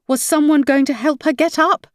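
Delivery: ordinary delivery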